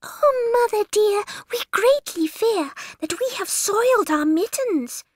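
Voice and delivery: very high worried kitten voice. Acted. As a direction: confess timidly like a kitten. Kittens confess to mother.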